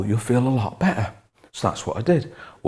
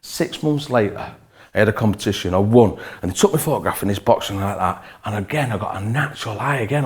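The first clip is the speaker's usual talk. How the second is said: ordinary delivery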